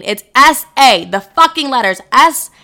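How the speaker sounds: fed up, hammering the letters; exasperated, getting mad. Real, not acted. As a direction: spell it out in real irritation. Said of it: "why I'm getting so mad".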